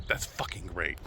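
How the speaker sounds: low, muttered sarcasm; sarcastic. Real, not acted. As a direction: spit out a sour "great" under your breath. Sarcastic about wasted day.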